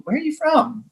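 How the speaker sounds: high, curious casting director. Retold or imitated.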